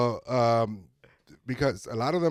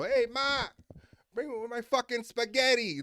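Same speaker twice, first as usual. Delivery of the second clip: Italian-American New Yorker impression, pitched way up; mock New York Italian; 11 semitones higher than usual. Comic mocking New York Italians who don't speak Italian, high-pitched mock New York Italian. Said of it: "they talk like that".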